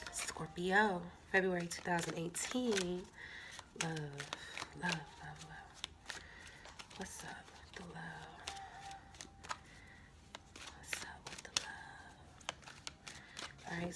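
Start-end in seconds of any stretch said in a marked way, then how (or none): 3.8-6.2 trailing off into a near whisper; hushed murmur. Acted. Dramatic card reading murmur. 7.0-11.7 breathy whispered question; teasing whisper. Acted. Playful teasing whisper to viewers.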